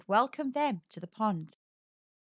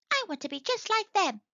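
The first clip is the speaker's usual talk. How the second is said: high, quick tadpole voice; little tadpole dreaming of being a frog; eager tadpole; 9 semitones higher than usual. A narrator's reading. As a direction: think it aloud, keen and small. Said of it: "thought Tapple".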